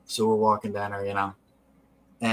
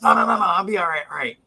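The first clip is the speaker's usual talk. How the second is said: excited reassurance; 6 semitones higher, 4 dB louder, faster than usual. Retold or imitated. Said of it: "He goes".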